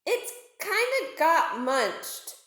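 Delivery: slow, sheepish admission. Acted. Spike admits to his sister, slowly and sheepishly. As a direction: drag it out, embarrassed.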